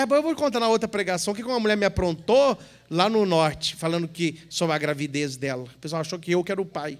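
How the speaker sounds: normal talking voice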